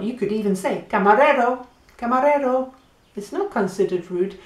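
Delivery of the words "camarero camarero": raised call across a café; calling the waiter. Shown as a demonstration. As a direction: call out to the waiter, louder and higher.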